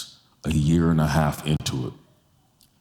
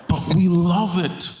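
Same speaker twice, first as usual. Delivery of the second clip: impassioned preaching; 11 semitones higher than usual. Acted. Pastor pressing the church to embrace differences, impassioned preaching. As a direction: preach it home, each phrase climbing higher. Preacher builds to full force.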